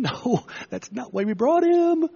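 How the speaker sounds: high, slow comic protest; flustered friends. Retold or imitated. Friends on the roof protesting to Jesus, high and comic. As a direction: squeal it like the baffled friends. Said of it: "The guys on the roof are thinking".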